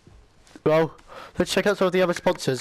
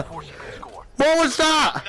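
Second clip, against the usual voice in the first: high, alarmed yell; startled cry; 10 semitones higher, much slower than usual. Real, not acted. Player hit mid-match, startled cry. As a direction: cry out in shocked confusion.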